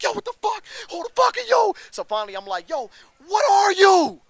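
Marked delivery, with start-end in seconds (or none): 0.0-1.7 high, loud startled yell at a shadow; yelling at someone hidden in the dark. Retold or imitated. Jumpy rider shouting at a dark figure, high startled yell. 2.7-4.2 high, slow, loud shout into the dark. Retold or imitated. Rider shouting at a stranger in darkness, slow high shout.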